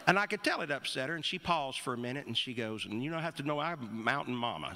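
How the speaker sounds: ordinary talk, nothing marked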